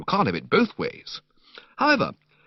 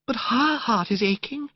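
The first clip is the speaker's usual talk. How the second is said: put-on gushing, dreamy young woman; 12 semitones higher than usual. Narrator voicing Madeline to Bertie in the park, high dreamy gush. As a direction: coo it like a sentimental girl.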